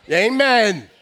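loud preacher-style call out; rousing call. Real, not acted. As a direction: shout it to rally the congregation. Calling out to the congregation.

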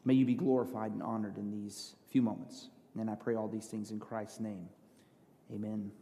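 soft, hushed pastoral prayer; quiet prayer. Acted. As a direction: pray it softly and reverently. Opening prayer before sermon.

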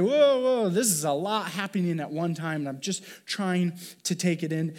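high, loud "whoa" easing into explanation; mock-startled, overwhelmed reaction; overwhelmed worry. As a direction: act out being swamped by it all. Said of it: "maybe the worry is like".

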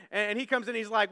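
ordinary talk, nothing marked

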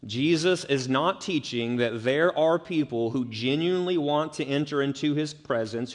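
ordinary talk, nothing marked

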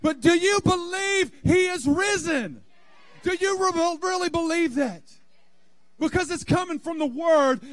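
high, loud, driving sermon shout; fiery preaching. Acted. Pastor urging the church to rise, shouting. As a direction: preach it hard and raise the roof.

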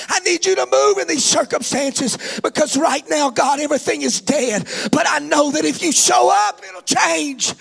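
starting level, rising high and loud into a sung cry; prayer building to a shout. Real, not acted. Preacher praying for his family, building from plea to shout. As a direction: pour it out, climbing all the way.

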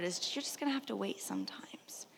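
hushed whisper. Real, not acted. Preacher whispers that you must wait. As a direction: drop to a whisper to land it. Soft emphasis.

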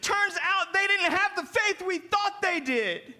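fervent preacher's build; preaching. Acted. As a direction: preach it to the congregation. Preaching with rising force.

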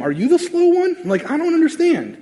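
high, mock-bewildered question; high puzzled mockery. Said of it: "maybe they're asking him".

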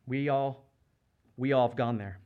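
usual speaking voice

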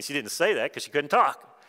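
normal talking voice

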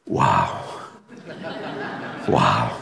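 quiet, low wow broken by a laugh; marvel, then laugh softly; hushed, laughing wonder; awed laughing wow. Retold or imitated. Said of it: "commentary on that when he says".

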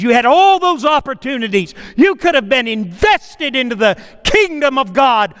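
loud, high rebuke from God, hammered out; God condemning the rich man, preached at full force; full-force preaching. Acted. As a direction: thunder the judgment down on the rich man.

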